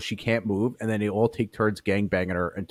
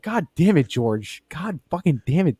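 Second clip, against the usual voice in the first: exasperated yell; 7 semitones higher than usual. Retold or imitated. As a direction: cry out in disgust. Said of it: "And I was like".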